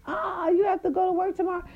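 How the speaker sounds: high, sneering mock pity. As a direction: squeal it with fake sympathy. Mocking the other woman.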